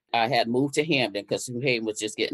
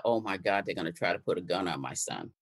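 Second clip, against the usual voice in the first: retold dread; 6 semitones lower, 7 dB quieter than usual. Retold dread, cuffed at police station.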